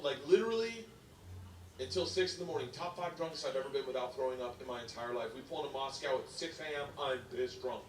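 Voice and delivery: ordinary talk, nothing marked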